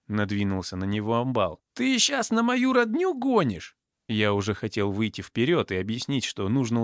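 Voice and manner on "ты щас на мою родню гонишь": slow, high, threatening question; acted angry challenge. A narrator's reading. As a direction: stretch it out high, picking a fight. Drunk thug threatens Roma. (угрожающий вопрос, высоко и врастяжку)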